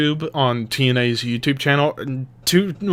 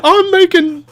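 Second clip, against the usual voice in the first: much higher, louder ranting; mock angry boss; 5 dB louder than usual. Billy yelling at two idle guys, hammed-up rant. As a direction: rant at the slackers in a put-on voice. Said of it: "He's like".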